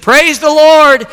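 high, loud, drawn-out cry of praise; fervent praise. Retold or imitated. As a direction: call it out to heaven as the old lady. Praying old lady, acted in story.

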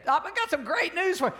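high-pitched excited herald; acted excitement, voice leaping high; excited announcement. Paul voiced announcing good news.

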